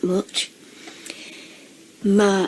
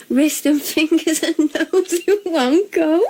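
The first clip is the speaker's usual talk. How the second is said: laughing; 7 semitones higher than usual. Real, not acted. Laughing while reading a comment.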